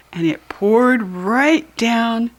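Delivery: usual speaking voice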